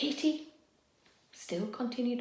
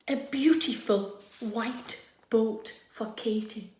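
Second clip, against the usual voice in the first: ordinary delivery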